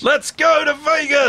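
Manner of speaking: high, slow giddy yell; excited shout. Acted. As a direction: cheer it out. Partner joins the celebration.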